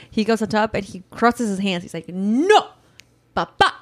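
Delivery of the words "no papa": lightly imitated bossy little kid; authoritative toddler. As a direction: mimic a toddler laying down the law. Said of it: "So now he's authoritative".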